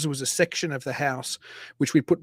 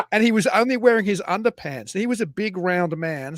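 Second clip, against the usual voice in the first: ordinary talk, nothing marked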